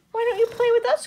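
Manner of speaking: very high, loud, eager invitation; SpongeBob. A narrator's reading. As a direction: plead to play in a high cartoon voice. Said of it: "asked SpongeBob".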